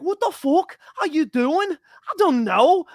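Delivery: high, loud imitation of a Cockney accent, dragged out at the end; Cockney accent impression. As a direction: mimic Darren Till's Cockney accent, pitched high. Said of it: "that crazy Cockney accent that he's got".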